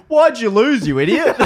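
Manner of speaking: loud, high mock heckle through laughter; jeering naysayer. Naysayer co-host heckles Napoleon in the room, mock jeering. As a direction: heckle with comic outrage. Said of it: "Naysayer [name]'s in there".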